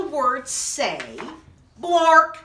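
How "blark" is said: loud nonsense word. Acted. Reading forced nonsense word.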